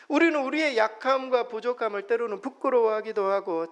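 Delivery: ordinary talk, nothing marked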